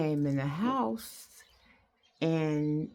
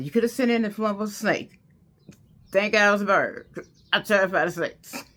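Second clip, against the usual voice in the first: louder, fast and emphatic; loud, quick, half-laughing relief; loud relieved outburst; 4 semitones higher, 7 dB louder than usual. Real, not acted. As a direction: blurt out your relief it wasn't a snake. Relieved outburst about snakes.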